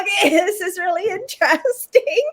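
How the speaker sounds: high, laughing mock interest; nervous laugh. Patient watching her own throat, laughing nervously. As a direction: laugh it off uneasily. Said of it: "I'm like".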